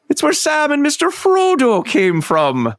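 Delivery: put-on high, slow hobbit voice with a rural English accent; Samwise accent. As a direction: play Sam talking about Frodo, slow and high. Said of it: "and wrong accent".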